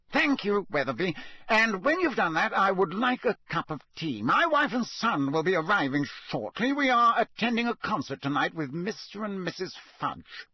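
high, strained voice of a man gone mad; addled ministry boss giving orders to a tree; deranged official. A narrator's reading. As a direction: dictate orders to no one, unhinged. Crouch talking to tree again.

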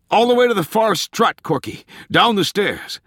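loud, high-pitched urgent call. A narrator's reading. Tolland to panicking Corky out in front, calling out loudly. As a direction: shout directions to someone well ahead. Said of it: "Tolland called out to him".

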